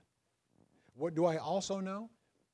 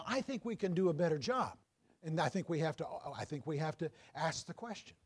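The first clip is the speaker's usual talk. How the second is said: ordinary talk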